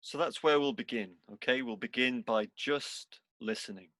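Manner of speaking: unhurried meditation guide, long pauses between phrases; guided meditation. Real, not acted. Teacher guiding seated students into body and breath, guided meditation. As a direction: lead them gently through it, leaving space after each instruction.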